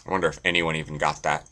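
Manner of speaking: ordinary talk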